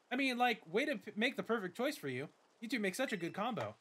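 lightly voiced eager rival girl; a touch of bubbly friendliness; Nemona. Nemona meets her new neighbor, lightly eager voice.

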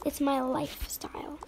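breathy whispered aside to herself; whispered kid aside. Pretend. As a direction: mutter it under the breath, unvoiced. Kid aside after protest.

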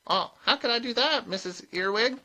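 put-on high, meek old witch; high-pitched show of innocence. A narrator's reading. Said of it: "said Granny meekly".

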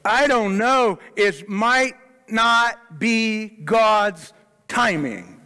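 mock the timid pray-er who hedges, up high and slow; hammed-up hesitant doubter; doubtful mom. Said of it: "and you go".

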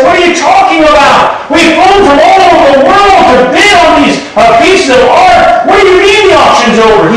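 furious. Retold or imitated. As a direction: shout it like cheated bidders. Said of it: "these people were furious".

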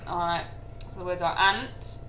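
ordinary talk

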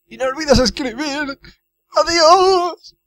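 very high mock-sobbing farewell; weeping cook. Cook seeing Guybrush off, in mock tearful wailing. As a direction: wail goodbye in a hammed-up tearful squeal. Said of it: "Está llorando, pobre cocinero".

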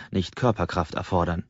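usual speaking voice

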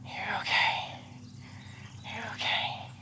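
soft, breathy reassurance to the horse; soothing whisper. Real, not acted. Trainer soothing a jumpy mare, gentle whisper. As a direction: whisper her calm, you're okay.

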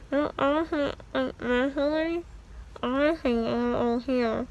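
talking around gauze pads; biting down on gauze; mouth full of gauze. Real, not acted.

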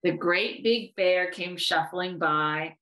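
usual speaking voice

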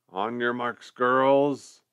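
booming military order; bellowing. A narrator's reading. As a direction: bellow it like a general. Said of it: "they heard him bellow".

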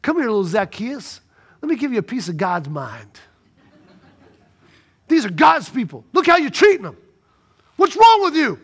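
imagined Jesus scolding the tax collector; rising scolding. Retold or imitated. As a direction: scold him harder with each line. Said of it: "he didn't say that".

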